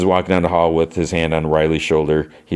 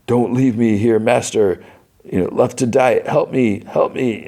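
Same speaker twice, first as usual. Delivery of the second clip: higher, acted desperate plea; retold panting sob; 6 semitones higher than usual. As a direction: beg in a mock sob, pitched up. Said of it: "panting and sobbing".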